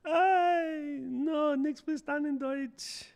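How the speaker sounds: hammed-up German accent, pretending not to understand; comic falsetto German accent; mock German accent. Jokey fake German after accent.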